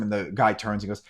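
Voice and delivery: usual speaking voice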